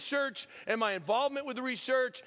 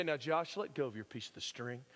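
soft youth minister; 7 semitones lower, 6 dB quieter than usual. Retold or imitated. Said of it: "some youth minister will say".

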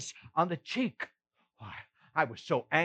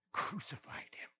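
barely voiced whisper; 18 dB quieter than usual. Peter recalling the cross, a grieving whisper. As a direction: let it fall to a whisper. Grief over the crucifixion.

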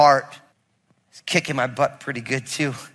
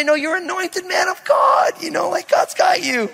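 mock tender reassurance; 13 semitones higher than usual. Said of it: "I want him to give me a hug and say".

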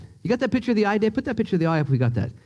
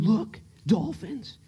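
soft, slow, slightly higher voice; hushed imitation of wife; 5 semitones higher, 5 dB quieter, much slower than usual. Preacher as his wife spotting dolphins from the ferry, hushed. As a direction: point it out softly and slowly.

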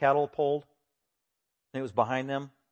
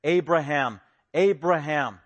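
"Abraham" called out twice, firm and falling; emphatic call; 6 dB louder, slower than usual. Retold or imitated. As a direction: call "Abraham" as God summoning him. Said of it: "So it is emphatic".

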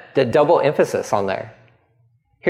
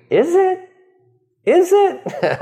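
teasing question; slower than usual. Retold or imitated. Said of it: "playful repeated question".

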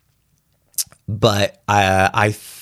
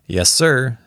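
plain reading voice

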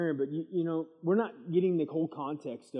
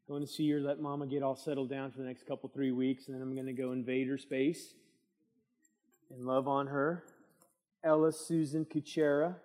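ordinary talk

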